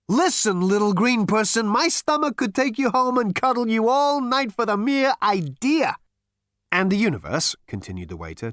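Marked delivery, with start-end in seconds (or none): 0.1-6.0 high, loud, impassioned tirade; passionate exclamation. A narrator's reading. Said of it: "exclaimed with passion". 6.7-7.6 raised, louder polite persistence; determined waiter. A narrator's reading. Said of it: "determined not to be deflected".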